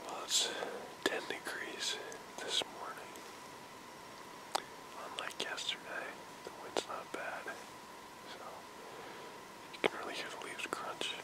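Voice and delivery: hushed hunter's whisper. Real, not acted. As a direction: whisper so the deer won't hear. Hunter whispers in tree stand.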